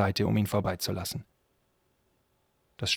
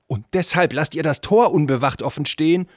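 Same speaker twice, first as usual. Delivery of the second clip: loud, raised-pitch indignant question; angry scolding; 6 semitones higher, 7 dB louder than usual. Acted. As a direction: take the guards to task. Prince scolds negligent guards. (empört und laut geschimpft)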